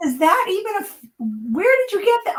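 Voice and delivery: high, disbelieving squeak; incredulous. Retold or imitated. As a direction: sound thrown by what you just heard. Wife retells her shocked reply.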